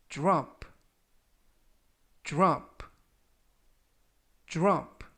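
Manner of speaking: "drop" with dr said like "jr"; model pronunciation. Shown as a demonstration. Teacher modelling "drop" for students. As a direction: say "drop" slowly, starting with a "jr" sound. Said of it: "라고 발음하시면".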